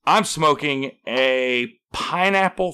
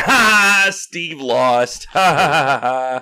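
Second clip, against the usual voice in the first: jeering pigeons; 5 dB louder, much slower than usual. Retold or imitated. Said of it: "all the pigeons sitting on the side of the road went".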